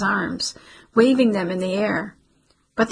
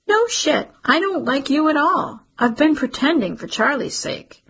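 much higher, biting contempt at the start; scornful outburst; 7 semitones higher than usual. Acted. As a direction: let the resentment burst out. Cece admits she dislikes him.

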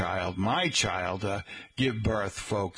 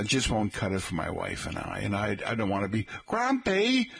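usual speaking voice

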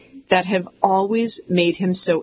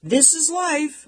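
much higher and slow, a mock call; dad calling; 9 semitones higher, slower than usual. Dad calling from upstairs, high slow mock call. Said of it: "my dad calls from down from upstairs".

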